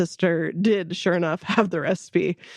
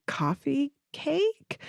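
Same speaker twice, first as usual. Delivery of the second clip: puzzled guess; 8 semitones higher, 4 dB quieter, much slower than usual. Retold or imitated. Guessing what son meant.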